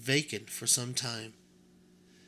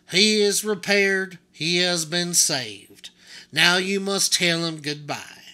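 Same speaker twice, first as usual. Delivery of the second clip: put-on booming, slow command to the boy; a stern old craftsman laying down the law; stern doll mender; 5 semitones higher, 10 dB louder than usual. Doll mender orders the boy to say goodbye, booming and slow. Lucius Clark voiced in story.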